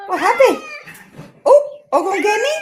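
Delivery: high, loud surprised cry; startled yelp. Real, not acted. Owner startled as cats rush her, loud cry. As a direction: jump at the cats.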